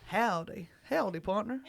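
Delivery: put-on Texan twang; Texan accent. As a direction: greet like a mock Texan cowboy.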